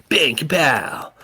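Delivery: loud, slow mock bang and kapow; explosion sound effect. A narrator's reading. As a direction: boom it out slowly. Narrator mimics mind imploding.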